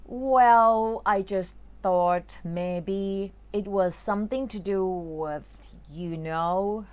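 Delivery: slow, careful read-aloud of the story. Acted. Teacher reading a Harry Potter passage slowly to students. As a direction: read each line slowly for learners.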